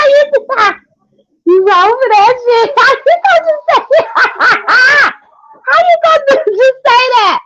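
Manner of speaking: squealing high through laughter; laughing. Real, not acted. To the chat about the roast, laughing high. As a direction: laugh out the words, can't hold it.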